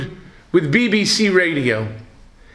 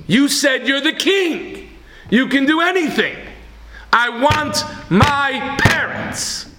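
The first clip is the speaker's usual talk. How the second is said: put-on boy's plea; 9 semitones higher than usual. Little boy insisting to the king he wants his parents. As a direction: plead with the king, hitting each word.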